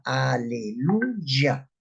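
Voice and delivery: aleluya slowly broken into its syllables. Shown as a demonstration. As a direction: sound out aleluya piece by piece. Teacher builds the word from parts.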